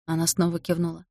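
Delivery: ordinary delivery, nothing marked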